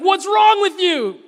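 exasperated outburst. Retold or imitated. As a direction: hurl the question at the king, shouting. Elisha scolds the king.

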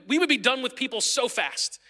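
normal talking voice

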